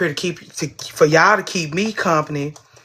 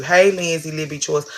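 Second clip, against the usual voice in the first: normal talking voice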